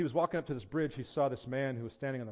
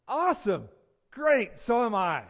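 loud, high-pitched cheer; acted growing excitement; thrilled agreement; 9 semitones higher, 8 dB louder than usual. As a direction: celebrate the match, stretching the words. Preacher thrilled at another match.

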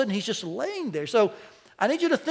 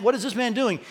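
ordinary talk

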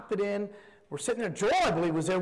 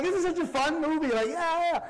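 excited; 9 semitones higher than usual. Retold or imitated. Excited quote about fun movie.

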